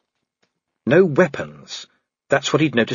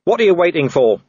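raised, loud goading cry; yelled challenge; 7 semitones higher, 5 dB louder than usual. Acted. Vimes goading the waiting werewolves, yelled. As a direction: yell it across at your pursuers. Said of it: "Vimes yelled".

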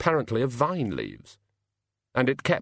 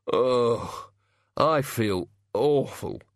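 groaning; much slower than usual. A narrator's reading. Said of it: "he said".

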